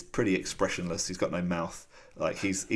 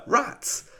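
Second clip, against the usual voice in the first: Rats squeaked much higher as a teasing echo; mocking high echo. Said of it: "And then she's like".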